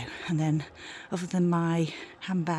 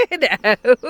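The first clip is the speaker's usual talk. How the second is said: laughing; 11 semitones higher, 10 dB louder than usual. Real, not acted. Dog on the bed.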